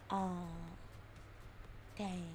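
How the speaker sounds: ordinary talk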